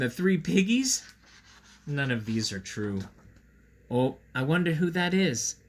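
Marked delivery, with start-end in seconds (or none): none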